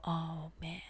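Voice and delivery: hushed, mock-profound reaction; mock awe. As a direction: say it softly, as if deeply moved. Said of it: "You're gonna go".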